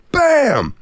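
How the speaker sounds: high sharp bam; punch sound. Retold or imitated. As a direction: smack the sound out. Punch sound in fight story.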